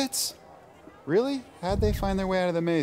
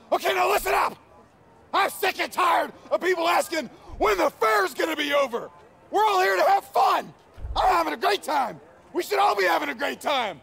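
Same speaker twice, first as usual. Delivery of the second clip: higher, loud furious yelling; angry shout; 11 semitones higher, 3 dB louder than usual. Acted. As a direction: blow up and yell at the team. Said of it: "Hey, calm down".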